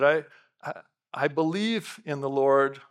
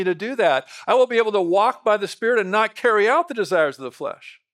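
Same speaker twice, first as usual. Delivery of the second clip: loud, ringing sermon delivery, higher and louder; full-force preaching; 8 semitones higher, 9 dB louder than usual. Acted. Pastor preaching hard to his congregation, loud and high. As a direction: preach it out to the whole church.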